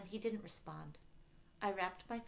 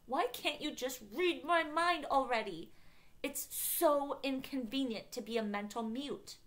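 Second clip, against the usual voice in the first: high, loud frustrated complaint; exasperated groan; 8 semitones higher, 5 dB louder than usual. A narrator's reading. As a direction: whine in exasperation. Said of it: "I groaned".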